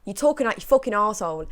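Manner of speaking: usual speaking voice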